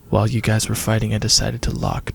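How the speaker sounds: low, ominous announcement; menacing caller voice. A narrator's reading. The caller tells the group about the lockdown, low menacing voice. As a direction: drop low as the hidden threat.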